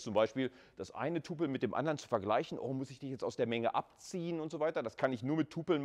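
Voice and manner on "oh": ordinary delivery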